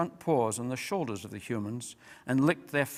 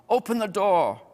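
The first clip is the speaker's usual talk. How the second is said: loud shout, pitched up; 6 semitones higher, 7 dB louder than usual. A narrator's reading. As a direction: shout the command.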